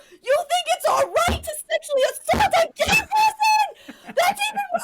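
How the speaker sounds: top-of-the-lungs high shriek; retold full-force furious yelling; screaming in outrage. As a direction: scream the accusation, utterly incensed. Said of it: "I was screaming at the top of my lungs at this woman".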